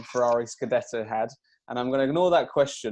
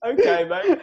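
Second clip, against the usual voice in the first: awkward placating; 5 dB louder than usual. Retold or imitated. Uneasy reply to the man.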